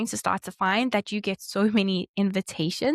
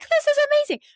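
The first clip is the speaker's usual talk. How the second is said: put-on much higher, louder, giddy girl's squeal of joy; Rapunzel's giddy delight; 6 dB louder, faster than usual. Rapunzel leaving her tower for the first time, squealing with joy. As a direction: gush like a princess free at last. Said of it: "And then she's like".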